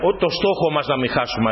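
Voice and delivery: ordinary delivery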